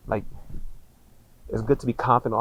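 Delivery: usual speaking voice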